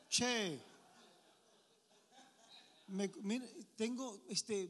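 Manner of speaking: normal talking voice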